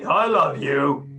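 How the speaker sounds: "I love you" slow and flat, dragged out, not meant; drawn-out "I love you" that rings false; insincere I love you. Shown as a demonstration. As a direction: say "I love you" slowly and lifelessly.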